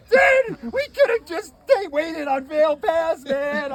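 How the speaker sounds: high, loud frantic complaint; freaking out. Retold or imitated. Driver snapping at his friend in the blizzard, frantic yell. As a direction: panic at your buddy while you can't see the road. Narrator freaking out driving blind.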